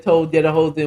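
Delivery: usual speaking voice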